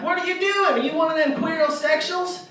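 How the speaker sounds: high, loud, alarmed outrage. Acted. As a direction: demand it in hammed-up disgusted shock. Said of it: "he got all upset about it".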